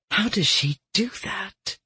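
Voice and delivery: soft, hushed wonder; breathed in awe. Acted. Winter marvels at Marrow's magic, softly breathed awe. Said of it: "Winter breathed, her voice tinged with awe".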